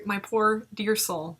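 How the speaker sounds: normal talking voice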